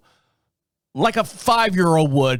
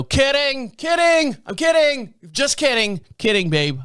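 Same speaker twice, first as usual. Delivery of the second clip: backpedaling; 9 semitones higher than usual. Retold or imitated. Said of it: "And I kinda go".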